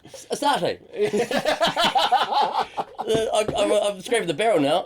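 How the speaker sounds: high, loud, laughing through names. Real, not acted. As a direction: giggle through every word. Listing names, cracking up.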